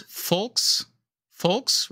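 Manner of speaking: "Volks" said slowly with an F sound; teaching "Volks" with the German F at the front; German pronunciation. Shown as a demonstration. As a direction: demonstrate "Volks" as "folks", drawn out. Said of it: "the W is a V".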